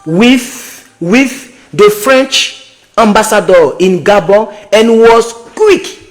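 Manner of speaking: slow, careful reading of English text; slow English read-aloud. Real, not acted. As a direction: read each English word out slowly. Reads English article aloud slowly.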